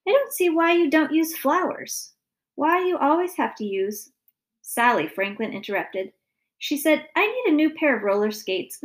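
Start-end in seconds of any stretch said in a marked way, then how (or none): 0.1-4.1 higher-pitched puzzled father; exasperated complaint. A narrator's reading. Father questioning mother's flower arrangements, exasperated. 7.1-8.8 put-on higher-pitched little girl demanding; pleading child. A narrator's reading. Daughter interrupting her parents about skates, pleading.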